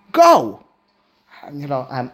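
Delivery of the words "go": one loud, high-pitched order; command. Acted. Esther orders Mordechai to act, commanding. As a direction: fire it out as a command. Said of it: "That's a command".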